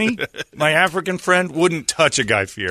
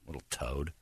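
ordinary talk

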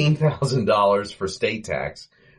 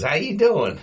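higher muffled Curly voice; imitating Curly; 9 semitones higher than usual. Curly greeting the detective from inside the rug, higher chirpy. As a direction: pop it up high like Curly.

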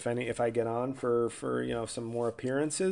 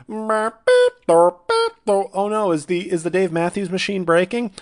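glitching robot; 8 semitones higher, 9 dB louder than usual. Pretend. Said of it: "two malfunctioning robots".

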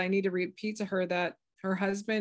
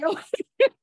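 high amused laugh; 13 semitones higher, 8 dB louder than usual. Retold or imitated. Guides laugh at her question.

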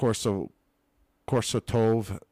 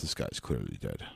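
low, soft clinical voice; deadpan low coroner; 9 semitones lower, 7 dB quieter, faster than usual. Pretend. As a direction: drone it like a bored coroner.

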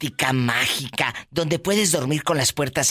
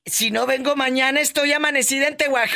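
higher, laughing playful exclamation; quoting herself; 9 semitones higher than usual. Retold or imitated. Host retells her joke to the radio crew, laughing and higher. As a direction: toss the joke off through laughter. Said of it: "le dije".

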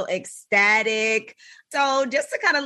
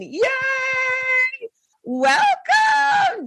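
cheer; 13 semitones higher, much slower than usual. Real, not acted. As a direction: cheer the guest in like a crowd. Cheering the guest's arrival.

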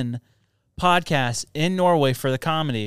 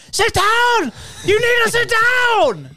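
high, loud barked order to sit down; mock yell; 5 dB louder, slower than usual. Flight attendant yelling at passenger to sit down, loud high bark.

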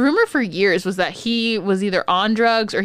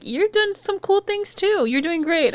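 gentle host voice; 11 semitones higher than usual. Retold or imitated. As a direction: soothe like a children's host. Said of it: "he was just like".

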